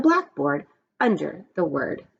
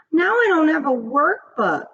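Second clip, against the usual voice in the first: high, louder whiny complaint; complaining; 10 semitones higher, 4 dB louder than usual. A narrator's reading. Joy complaining to the class, high whine. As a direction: whine about the lost workbook. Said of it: "complained Joy".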